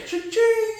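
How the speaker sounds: high sing-out cha-ching; playful cash-register squeal. Pretend. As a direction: imitate a till ringing, very high.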